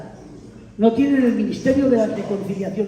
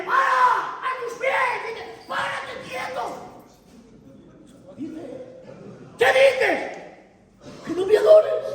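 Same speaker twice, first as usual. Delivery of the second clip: angel voiced much higher, protesting; alarmed angel; 12 semitones higher than usual. Angel refusing the apostle's worship, high and urgent. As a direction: stop them from bowing down, high and urgent. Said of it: "Y Ana dice".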